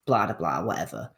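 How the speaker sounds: ordinary delivery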